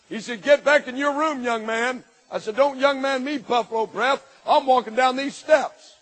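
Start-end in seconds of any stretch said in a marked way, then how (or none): 0.4-2.0 loud, higher barking order; a stepfather snapping at the boy; stern order. Retold or imitated. Stepfather to teen, loud stern command. 2.6-5.9 loud, higher defiant retort; defiant talkback. Retold or imitated. Teen to stepfather on the stairs, loud defiant retort.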